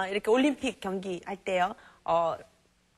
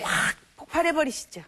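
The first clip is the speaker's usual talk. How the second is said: mock explosion; 7 semitones higher, 4 dB louder than usual. Shown as a demonstration. Lecturer mimics exploding anger.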